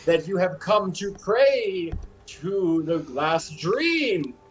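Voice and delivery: slow, halting greeting with knowing winks; awkward fake cultist. Doctor hinting to visitors to play along, stilted and conspiratorial. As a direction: stretch key words as a stilted pretender.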